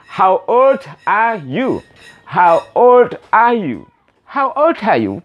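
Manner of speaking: put-on slow teacher drill, old stressed and falling; deliberate repeat-after-me model; slow model phrase. Shown as a demonstration. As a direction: spell it out slowly for young learners. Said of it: "Repeat after me".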